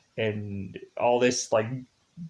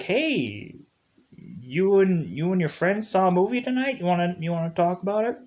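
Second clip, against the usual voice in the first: imitating a dad, higher and fast; gentle dad; 6 semitones higher than usual. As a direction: coax a scared son like a kind father. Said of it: "and he's like".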